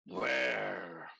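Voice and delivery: low, drawn-out monster demand; booming monster. Acted. Monster pie hunts the chef, low and booming.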